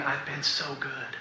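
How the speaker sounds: very soft, grieving quote; weeping. Retold or imitated. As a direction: say it softly as if weeping. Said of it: "and just weeping".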